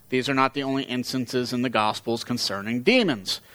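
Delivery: ordinary talk, nothing marked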